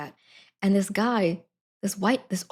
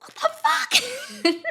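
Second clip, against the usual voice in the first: very high, slow, laughing; high laughing what-the; laughing disbelief; much slower than usual. Retold or imitated. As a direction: drag it out through laughter. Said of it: "I was like".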